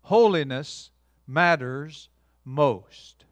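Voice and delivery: slow, every word weighed; solemn motto, spoken slowly; slow emphatic motto. Shown as a demonstration. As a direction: set the phrase down slowly and firmly. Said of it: "here's the phrase I came up with".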